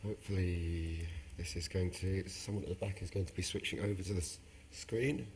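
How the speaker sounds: hoarse, strained voice losing power; raspy, worn-out voice barely holding. Real, not acted. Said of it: "I'm losing my voice".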